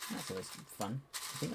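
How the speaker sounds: ordinary delivery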